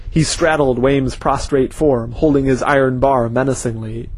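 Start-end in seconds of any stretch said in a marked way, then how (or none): none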